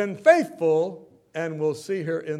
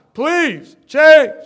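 tearful plea; 8 semitones higher, 14 dB louder than usual. Retold or imitated. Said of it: "I love you as if you were my own son".

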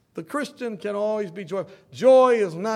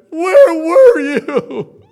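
slow, loud wailing question with a laugh; mock sobbing; 9 semitones higher, 15 dB louder, much slower than usual. Greeter Millie to absent members, loud mock sob. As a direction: wail it through mock tears. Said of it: "when they weren't there".

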